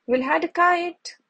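mildly anxious, as if sensing danger; nervous. A narrator's reading. Chip in the wagon, worrying about the storm, nervous. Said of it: "Chip said nervously".

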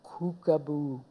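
very soft, eerie chant; hushed magic words. Acted. Boy finishing his head-shrinking curse, hushed slow chanting. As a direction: drop to a slow hush, like a secret spell.

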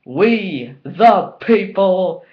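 loud, drawn-out rallying cry; catchphrase proclamation. Retold or imitated. Wrestling fan building up a reveal, loud slow proclamation. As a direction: proclaim it slowly and loudly, word by word.

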